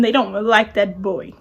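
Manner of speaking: usual speaking voice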